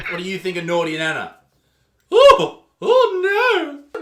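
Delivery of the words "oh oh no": high, loud shocked cry; shocked squeal. Real, not acted. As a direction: yelp in disbelief. Shocked reaction to video.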